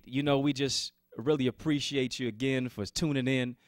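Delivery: ordinary talk, nothing marked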